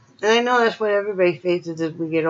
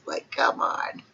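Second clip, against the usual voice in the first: whispered exasperated aside; 7 dB quieter, slower than usual. Real, not acted. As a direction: whisper it like an eye-roll. Aside about paying for meds.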